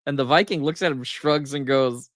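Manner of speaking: ordinary talk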